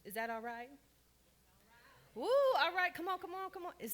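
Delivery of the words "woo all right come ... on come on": high, loud whoop to rouse the room; excited cheering. Real, not acted. Speaker hyping up her workshop audience, excited whoop. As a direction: cheer the crowd on, much higher and louder.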